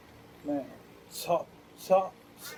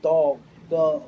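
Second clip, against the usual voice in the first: retold yelling; 7 dB louder, slower than usual. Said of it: "And she went".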